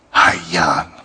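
low husky seductive greeting; husky sexy tone. Retold or imitated. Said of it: "in a husky, sexy tone".

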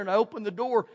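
ordinary delivery

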